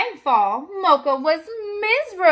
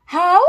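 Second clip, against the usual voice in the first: louder rising dog howl; 4 semitones higher, 6 dB louder than usual. Acted. Lonely dog howls at the golden moon, loud. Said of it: "howled as loudly as he could".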